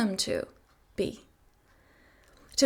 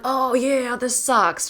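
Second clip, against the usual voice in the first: mock complaining; 3 semitones higher, 5 dB louder, much slower than usual. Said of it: "you're like".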